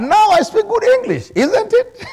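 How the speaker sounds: high, laughing brag about his English; laughing joke. Real, not acted. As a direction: tease the congregation, then burst out laughing. Jokes about own English.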